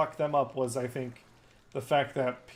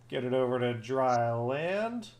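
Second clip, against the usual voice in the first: normal talking voice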